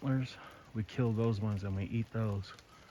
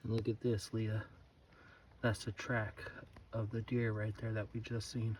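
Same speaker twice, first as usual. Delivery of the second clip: soft hushed voice, much softer; 13 dB quieter than usual. Real, not acted. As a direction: keep it down so the deer stays. Deer nearby, keeping quiet.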